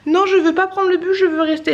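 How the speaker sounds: put-on high, pleading child voice; cute little girl. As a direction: imitate a small child protesting. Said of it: "sa petite voix aiguë et bien mignonne".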